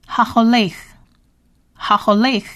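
"Haholech" said slowly and drawn out; Hebrew word demo. Shown as a demonstration. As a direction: model "Haholech" for learners. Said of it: "再来我们学第三个字". (慢读示范Haholech)

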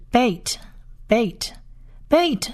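beit said slowly as "bate", spaced out; slow, separated repeats of beit; pronunciation demo. Shown as a demonstration. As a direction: model "beit" for learners, pausing between repeats. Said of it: "第三个字是".

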